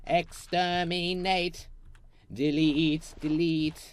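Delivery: slow, high, loud robotic Dalek. Retold or imitated. Gamer deleting blocks, Dalek robot voice. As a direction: grind out each syllable like a Dalek. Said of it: "Man, Doctor Who".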